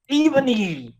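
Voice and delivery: high-pitched villain yell; acted yell. Voldemort yelling at the heroine, high hammed-up yell. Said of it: "he yelled".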